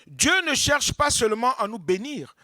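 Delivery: ordinary delivery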